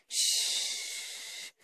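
long hushing "shh"; soothing shush. Pretend. Telling the listener not to cry, long soothing shush. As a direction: hush someone off to sleep.

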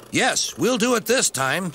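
high, eager assurance; confident driver. A narrator's reading. Driver confident of catching the train.

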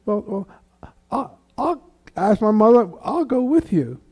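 put-on high-pitched, stumbling childlike eagerness; hopeful little-kid voice, acted out; eager child. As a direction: imitate a five-year-old inviting himself along. Retelling himself as eager child.

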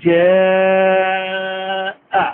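জাআ held very long; four-alif stretch. Shown as a demonstration. Teacher demonstrating the long madd on জাআ. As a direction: pull the vowel of জাআ out fully. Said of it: "teacher demonstrating".

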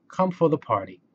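ordinary delivery

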